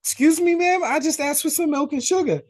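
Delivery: high-pitched, incredulous protest; mock affronted. Cop answering Nancy's telling-off, mock offended. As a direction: imitate taking offence, voice shooting way up. Acted offended reply to her scolding.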